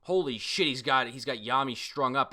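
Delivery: ordinary delivery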